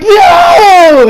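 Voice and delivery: high, loud cry of no; desperate shout. Retold or imitated. Fighter facing Vegeta, high desperate yell. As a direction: scream it as you're about to be blasted. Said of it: "It's like".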